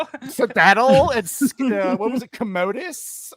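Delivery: put-on lightly done Valley Girl voice, slow; Vex Valley Girl voice. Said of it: "I'm gonna do Vex's Valley Girl voice".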